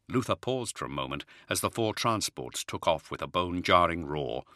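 normal talking voice

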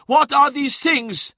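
loud, slow, high-pitched demand; angry demand. A narrator's reading. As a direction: shout the question, each word drawn out. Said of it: "demanded Skarsen".

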